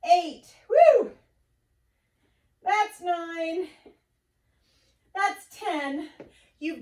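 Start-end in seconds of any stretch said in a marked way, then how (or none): 0.7-1.1 loud high whoop of celebration; excited whoop. Real, not acted. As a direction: cheer the finished set. Trainer cheering workout set done.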